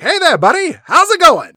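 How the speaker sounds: high, loud hammed-up American accent; mock all-American accent, booming and high; Colonel Moon. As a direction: shout a chummy greeting in exaggerated American. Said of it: "he's like".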